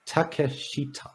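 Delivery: TAKESHITA said slowly, syllable by syllable, ta-ke-shi-ta; careful name pronunciation. Shown as a demonstration. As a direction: sound out all four syllables of Takeshita. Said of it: "He didn't pronounce his name correctly".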